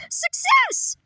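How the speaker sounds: very high, loud, crowing; gleeful triumph. Acted. Villain grabbing the spy vest, gleeful crowing. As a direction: crow your victory.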